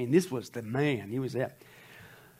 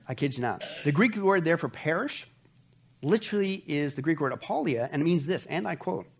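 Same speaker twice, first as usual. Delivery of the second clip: ordinary talk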